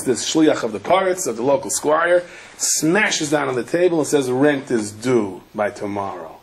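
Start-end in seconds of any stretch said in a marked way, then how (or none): 4.3-6.4 low menacing demand. Retold or imitated. Squire's messenger bangs the table.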